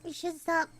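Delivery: resigned admission. Real, not acted. Gives in, late at night.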